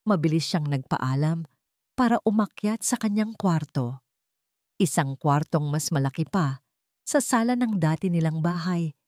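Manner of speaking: ordinary delivery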